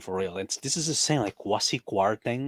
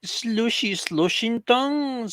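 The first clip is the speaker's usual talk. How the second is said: drawn-out silly name; 12 semitones higher, much slower than usual. Retold or imitated.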